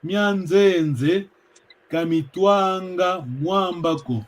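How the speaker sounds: slow, deliberate reading, every word spaced; slow proverb reading. Shown as a demonstration. Teacher reading a proverb aloud to students, slow and deliberate. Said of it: "Est-ce que vous avez compris".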